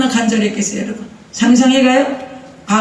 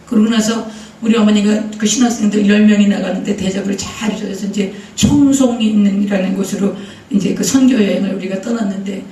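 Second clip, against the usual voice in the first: normal talking voice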